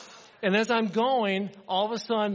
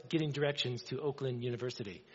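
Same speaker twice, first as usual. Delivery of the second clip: low, softer robotic assistant imitation; preacher imitating Siri's wrong answer; mimicking Siri; 6 semitones lower, 8 dB quieter than usual. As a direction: mimic the phone's flat computer voice. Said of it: "It goes".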